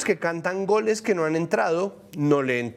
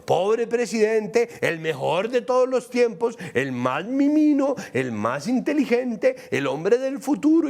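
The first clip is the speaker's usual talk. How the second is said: higher, mock-adoring fan voice; sarcastic gushing; 6 semitones higher than usual. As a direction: gush over him in mock worship. Mocking his admirers' praise.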